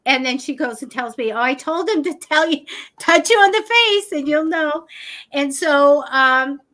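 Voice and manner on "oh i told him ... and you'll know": cheerful lady. Retold or imitated. Quoting the lady's explanation.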